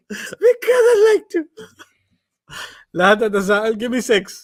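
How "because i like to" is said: put-on high, slow sobbing child voice; crying child. Daughter answering her mother through mock tears. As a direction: whimper the answer like a hurt toddler. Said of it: "That's what I say to myself".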